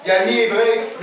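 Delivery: normal talking voice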